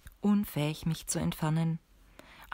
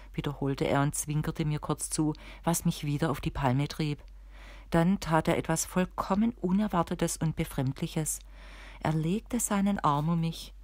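ordinary delivery

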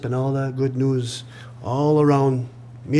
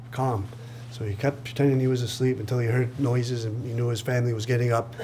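usual speaking voice

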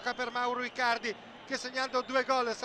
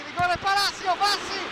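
higher, louder rising call; excited commentary; 5 semitones higher, 4 dB louder than usual. Real, not acted. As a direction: call the chance with urgency. Near chance in match commentary.

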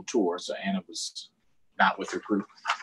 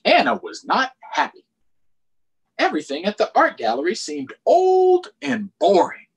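put-on bright, higher read-aloud to kids; storybook reading; 10 semitones higher, 6 dB louder than usual. A narrator's reading. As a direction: read it like storytime for little ones. Reading picture book aloud.

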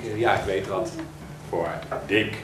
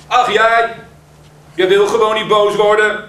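louder, higher frustrated yell; frustrated shout; 11 semitones higher, 11 dB louder than usual. Acted. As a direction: Kuik yells at his friend in exasperation. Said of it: "schreeuwt Kuik".

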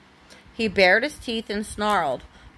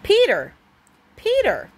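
angry call; 4 semitones higher than usual. A narrator's reading. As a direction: scold from across the meadow. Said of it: "an angry voice made him jump".

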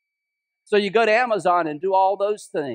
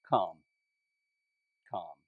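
quiet call; 12 semitones lower, 10 dB quieter than usual. Acted. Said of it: "the shocking response of Jesus in one word".

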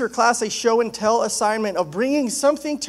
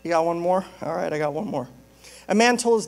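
normal talking voice

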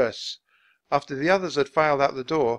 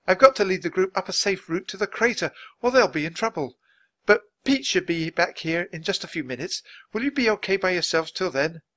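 lightly voiced worried woman, higher; worried Nancy; 8 semitones higher, 5 dB louder than usual. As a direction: fret over leaving the children. Nancy worries about leaving kids.

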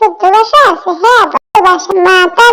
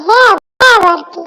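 ordinary delivery

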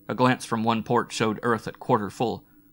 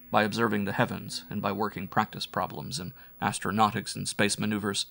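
ordinary delivery

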